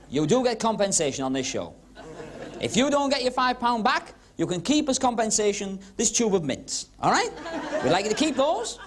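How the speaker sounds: ordinary talk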